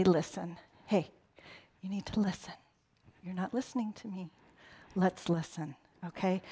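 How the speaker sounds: put-on calm a kid down without raising your voice; gentle parent voice. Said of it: "What do they say instead".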